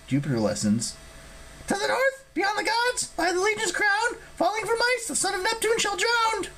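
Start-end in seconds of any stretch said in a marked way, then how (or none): 1.7-6.5 very high, squeaky chanting recital; Ella reciting prophecy. Acted. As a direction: recite verse in a tiny high creature voice. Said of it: "she switched to Latin".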